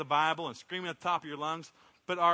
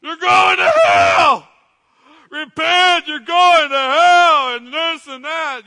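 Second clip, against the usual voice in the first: hammed-up hellfire yell, much higher and louder, slow; mock street-corner ranting at passers-by; imitating a street preacher's scream; 11 semitones higher, 19 dB louder, much slower than usual. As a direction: bellow it like a fire-and-brimstone preacher on a box. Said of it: "he was screaming as loud as he could".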